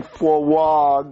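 "frog" drawn out as "fuh-wog"; imitated mispronunciation. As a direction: mimic the W slip in "frog", very slowly. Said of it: "You're saying frog".

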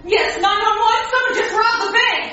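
frantic. Acted. Frantic call to police.

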